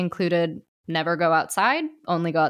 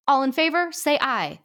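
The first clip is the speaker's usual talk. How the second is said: raised, formal call for votes; 8 semitones higher, 3 dB louder than usual. Acted. Judge calling the ghosts to vote, lightly formal. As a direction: put it to the room like a judge.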